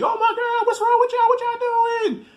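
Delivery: very high, loud mock panic; hammed-up shriek at two men fighting; screaming girlfriend. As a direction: act out her alarmed screaming, far above normal pitch. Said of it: "And then she".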